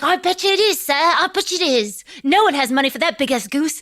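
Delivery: put-on high boy's voice; cheeky street boy. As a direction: pitch way up for the cocky kid. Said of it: "he's like".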